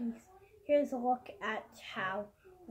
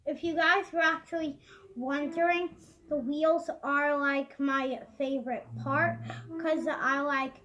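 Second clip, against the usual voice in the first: excited; 4 semitones higher, 7 dB louder than usual. Real, not acted. Excited about favorite part.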